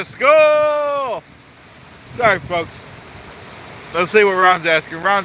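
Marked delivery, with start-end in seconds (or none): 0.0-1.2 high, eager shout-out of a name; sudden bright call, pitched up. Real, not acted. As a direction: hail a friend you just spotted. Greeting a chat member.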